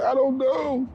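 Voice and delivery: high, wobbly mock voice of his partner; high crying pregnant partner. Partner answering why she's crying, high mock tearful voice. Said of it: "She's like".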